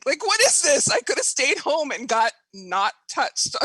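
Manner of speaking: high, loud retold exasperation; loud, incredulous inner protest; exasperated. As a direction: demand an explanation in mock outrage.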